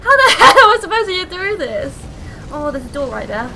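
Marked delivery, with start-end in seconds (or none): 0.0-2.0 high, loud exasperated shout with a laugh; frustrated yell. Real, not acted. Gamer stuck on a hard level, yelling half laughing.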